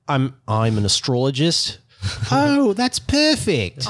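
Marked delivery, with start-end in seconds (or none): 2.3-3.9 drawn-out, high, sarcastic "oh, that's perfect"; mock delight. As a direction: stretch it slowly, way up high, dripping sarcasm. Mock reaction to astrologist.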